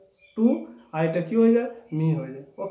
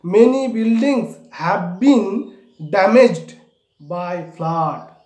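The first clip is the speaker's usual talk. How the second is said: slow dictation; 7 dB louder, much slower than usual. Shown as a demonstration. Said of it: "কি?".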